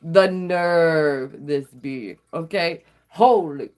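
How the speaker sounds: slow, stretched-out outrage; drawn-out indignation. Shown as a demonstration. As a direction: drag each word out for a chant-along. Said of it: "say it with me".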